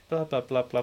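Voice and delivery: normal talking voice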